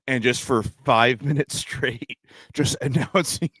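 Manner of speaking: laughing through the words; giggling while explaining. Real, not acted. Laughing while describing bit.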